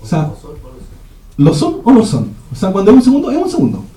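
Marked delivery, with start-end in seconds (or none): 2.5-3.8 "segundo" pitched high and pressed home; insistent emphasis. Real, not acted. Preacher insisting to the congregation, high and emphatic. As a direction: drive home that a second means a second.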